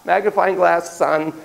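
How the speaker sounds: ordinary delivery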